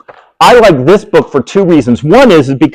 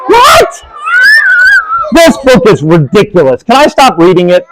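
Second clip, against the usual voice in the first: hammed-up flustered reader; 10 semitones higher than usual. Reader to giggling kids, hammed-up mock panic. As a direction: ham up being tricked by the book.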